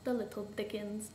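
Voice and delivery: low-volume mutter; soft, under-the-breath remark. A narrator's reading. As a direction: mumble it to yourself. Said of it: "muttered Ed".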